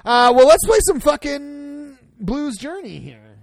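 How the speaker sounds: slow, loud, hyped kickoff; rally everyone to start; excited announcement. Real, not acted.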